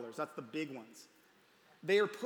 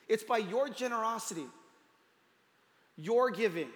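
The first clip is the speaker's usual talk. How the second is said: preaching; 4 semitones higher than usual. Real, not acted. Pastor urges the congregation that kids see their giving, rising preaching. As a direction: drive the point home to the congregation.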